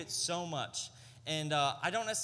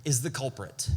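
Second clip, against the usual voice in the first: louder, driving preaching; building sermon; 7 dB louder than usual. Acted. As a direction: press the point home with weight. Preaching at full force.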